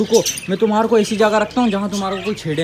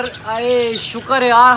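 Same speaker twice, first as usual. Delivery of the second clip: ordinary delivery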